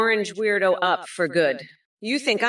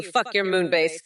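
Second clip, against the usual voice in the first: normal talking voice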